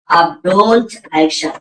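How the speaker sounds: drawn-out, deliberate command; teacher spelling out a phrase; slow model command. Shown as a demonstration. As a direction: say it slowly so the class can copy.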